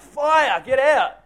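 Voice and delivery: high, loud shouted alarm; panicked yell of fire; yelling a warning. Retold or imitated. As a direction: shout to clear the room. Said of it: "Yelling out".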